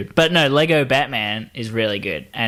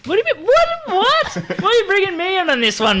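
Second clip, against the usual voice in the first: high squeaky indignant what; hammed-up disbelief; mock outrage. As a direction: play up being blamed, high and fast. Mock outrage at being blamed.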